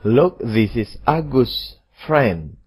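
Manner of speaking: slow, careful example sentences; slow classroom dictation voice; slow model reading. Shown as a demonstration. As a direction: read each word slowly, like a teacher modelling. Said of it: "tetapi pada nomor tiga".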